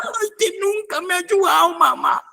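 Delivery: very high, wailing mock-cry. Preacher mocks the son wailing to his mom, high mock crying. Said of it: "mire cómo lloraba".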